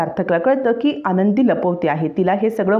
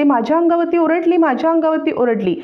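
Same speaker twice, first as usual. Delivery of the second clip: higher-pitched mock wail of a wronged woman; fake outcry; 7 semitones higher than usual. A narrator's reading. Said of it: "Vrinda कांगावा करायला लागते". (उंच आवाजात नाटकी कांगावा)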